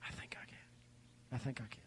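whispered little engine's self-encouragement; soft, hushed chant; little train. Acted. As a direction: whisper it as a small train urging itself on. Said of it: "be like the little train".

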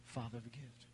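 very soft, low whisper; hushed, intimate murmur; still small voice. Acted. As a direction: whisper it to one listener. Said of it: "Hear the still small voice".

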